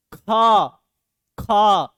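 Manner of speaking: खा loud with a strong puff of air from the throat; sound demo. Shown as a demonstration. Teacher asking pupils to say खा with him, loud and aspirated. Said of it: "बोल के देखिए".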